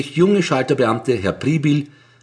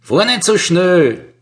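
slow, loud, higher command; demanding; 7 semitones higher, 5 dB louder, slower than usual. A narrator's reading. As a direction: order him to slow down, firmly. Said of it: "fordert er".

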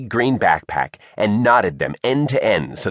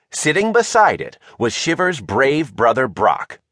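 usual speaking voice

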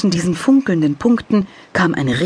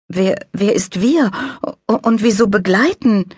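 put-on confused child; 7 semitones higher than usual. A narrator's reading. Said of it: "Maxi verstand immer noch nichts".

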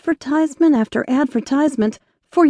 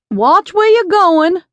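louder and higher, slow call after her; 6 semitones higher, 5 dB louder, slower than usual. Acted. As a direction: call out a warning to someone walking away. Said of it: "he called out after her".

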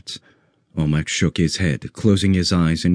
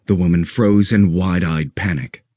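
plain reading voice

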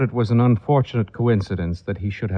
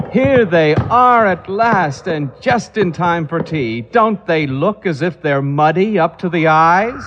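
high and loud, suddenly bright; light up as the hunters appear; aunt brightening as her men come home; bright, alert; 6 semitones higher, 4 dB louder than usual. Acted. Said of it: "suddenly brightened into alert attention".

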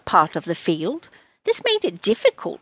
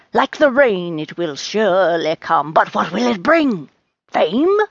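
theatrical fortune teller. Acted. Rose foretells Charlie's future, theatrical pronouncement. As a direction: proclaim it like a mystic, building in volume.

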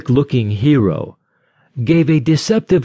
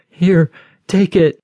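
frightened, higher plea; quivering voice; 7 semitones higher than usual. Acted. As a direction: offer it up trembling with fear. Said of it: "with a quivering voice".

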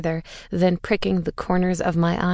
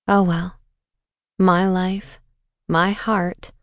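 usual speaking voice

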